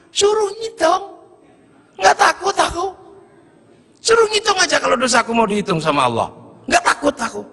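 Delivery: high, bold, unafraid; cocky defiance. Acted. Villager brushes off God's count of his sins, cocky. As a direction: toss it off, daring the count.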